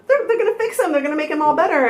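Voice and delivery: retold high-pitched self-reassurance; re-enacted anxious hope; hopeful babble. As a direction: say it high, as if convincing yourself.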